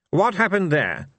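high-pitched suspicious query. Acted. Fate questioning the mortals on what happened, suspicious. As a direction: question it sharply as a wary god. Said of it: "said Fate suspiciously".

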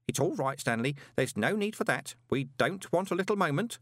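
put-on anxious old postman. A narrator's reading. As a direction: placate the boy carefully.

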